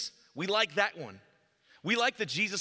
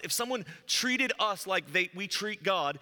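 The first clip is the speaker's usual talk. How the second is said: ordinary talk, nothing marked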